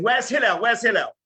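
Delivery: retold holler for someone inside; imitated shouting. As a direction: call out as if hollering at someone's door. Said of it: "and shouting".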